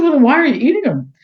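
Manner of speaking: high, loud puzzled question. Retold or imitated. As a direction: ask in disbelief. Retold puzzled question to client.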